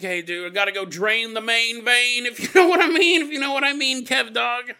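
high, loud goofy bro voice; jokey dude talk. Retold or imitated. Miles excusing himself to drain the main vein, jokey and loud. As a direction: crow it like a silly euphemism between buddies. Acting out a jokey bathroom announcement.

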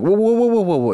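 alarmed whoa. Retold or imitated. Protesting to jail inmates.

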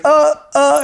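high, loud tongue-tied stammer; barely able to talk. Acted. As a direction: imitate a kid too rattled to speak. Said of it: "he can barely talk".

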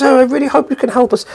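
put-on high-pitched, hopeful girl voice; imitated pleading girl. Girl below the window hoping for Lol's help, high pleading voice. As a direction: put on a girl's high, imploring voice. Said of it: "And the girl goes".

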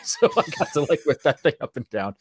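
laughs through the words, giggling; laughing. Real, not acted. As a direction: let a real laugh break through the line. Laughing about whipping the car.

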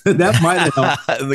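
slow amused repeat through a laugh. Shown as a demonstration. Retelling the coach story, amused laughing repeat. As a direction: laugh it out slowly, tickled by the irony.

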